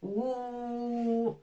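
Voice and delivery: "Ou" held long and even. Shown as a demonstration. Teacher demonstrating the long vowel to students. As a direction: hold "Ou" as one long vowel. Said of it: "C'est plus long".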